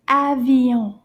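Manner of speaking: "Avion" drawn out and loud for repeating; teacher's careful, stretched "Avion"; slow model. Shown as a demonstration. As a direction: say "Avion" slowly and firmly. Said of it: "A little bit faster".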